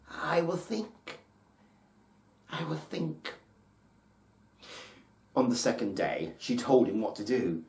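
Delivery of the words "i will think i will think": 1 hushed musing mother. A narrator's reading. Mother pondering in hiding.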